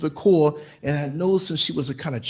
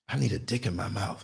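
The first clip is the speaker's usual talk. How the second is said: much softer, lower confiding voice; hushed confession; 7 semitones lower, 10 dB quieter than usual. Retold or imitated. Passenger confiding to the driver, low and hushed. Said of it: "you know what she's telling me".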